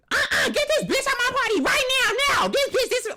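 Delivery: high, loud mock yell to throw someone out; hammed-up high shriek; pretend screaming. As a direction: scream like you're kicking her out of the party. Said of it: "I could have literally been like".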